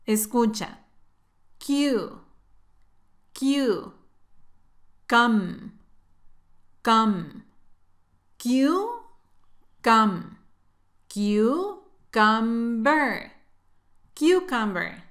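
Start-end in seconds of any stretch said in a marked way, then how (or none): 1.6-10.4 "Q" as kyoo, "Cum" as kum, each on its own; teacher isolating "Q", then "cum"; syllable breakdown. Shown as a demonstration. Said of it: "tiene pronunciación muy diferente. Escucha". 11.1-13.3 "Cucumber" drawn out very slowly as kyoo-kum-ber; slow teaching pronunciation of "Cucumber"; syllable by syllable. Shown as a demonstration. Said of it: "tiene pronunciación muy diferente".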